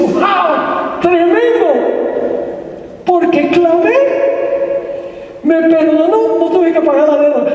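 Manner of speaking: high, elated cry of relief; acted jubilation. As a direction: play a debtor leaping for joy. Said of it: "Se va saltando de alegría".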